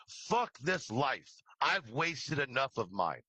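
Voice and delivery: normal talking voice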